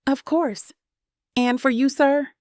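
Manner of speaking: bright, high Southern waitress voice; cute Southern accent. Acted. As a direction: chirp it up like a sweet Southern server. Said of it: "she asks in a cute Southern accent".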